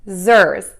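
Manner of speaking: "zers" loud, with buzzing Z at both ends; Z sounds. Shown as a demonstration. Teacher breaks down the second syllable, buzzed "zers". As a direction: buzz both S letters in "zers" as Z. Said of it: "use the letter S as a Z".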